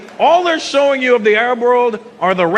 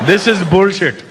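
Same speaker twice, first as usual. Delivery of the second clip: grumbling Indian audience. Retold or imitated. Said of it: "That's the look on their face".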